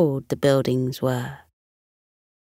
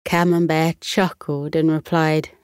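usual speaking voice

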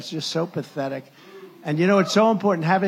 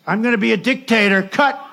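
loud and higher, laughing; mocking news edit; 5 semitones higher, 8 dB louder than usual. Speaker mocking the news clip, loud with a laugh. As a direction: laugh while aping the cut clip. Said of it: "So they have me on the news".

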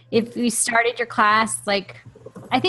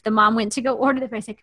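usual speaking voice

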